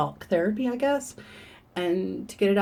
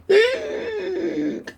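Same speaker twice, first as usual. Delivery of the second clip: sharp high theatrical gasp; mock gasp; 12 semitones higher, 5 dB louder than usual. Strangers hearing the news, an overdone shocked gasp. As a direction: gasp like you're shocked for someone. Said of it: "people are like".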